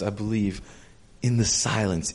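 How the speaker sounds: normal talking voice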